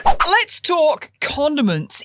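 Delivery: high, slow showman opener; dramatic announcement. Acted. Host to listeners, dramatic slow intro. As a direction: announce the topic like a game show host.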